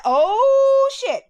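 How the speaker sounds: long high loud "oh", then drop; exaggerated oh-no realisation; retold shocked reaction. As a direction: draw out the oh in mock alarm. Reacting to news of juvie.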